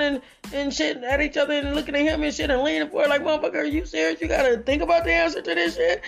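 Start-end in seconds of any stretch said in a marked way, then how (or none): 3.2-6.0 high pitched disbelief; mock shocked crowd; incredulous. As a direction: voice the stunned onlookers. Voicing the audience reaction.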